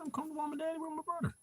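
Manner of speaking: tiny, very high, soft doggy voice; pet dog's voice. Pretend. Owner speaking for the pup on his lap, high pet voice. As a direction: speak for the pup in a small squeaky falsetto. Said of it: "She said".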